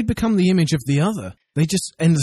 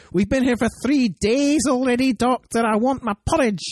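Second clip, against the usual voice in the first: mock Amy; 6 semitones higher than usual. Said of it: "and Amy be like".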